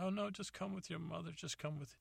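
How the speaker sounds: high, soft coaxing mother; mom voice. Retold or imitated. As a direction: coax him gently like his mom. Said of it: "She's like".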